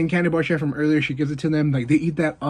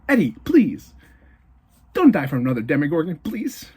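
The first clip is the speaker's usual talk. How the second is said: mock pleading. Viewer begging Eddie not to die, mock pleading. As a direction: beg like an anxious fan, playfully.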